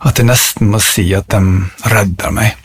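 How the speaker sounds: measured poem recital with long pauses; poetry reading. Acted. Reciting a favourite tree poem to listeners, poetry reading. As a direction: let each phrase of the poem settle.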